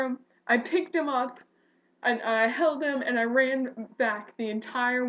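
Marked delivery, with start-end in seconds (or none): none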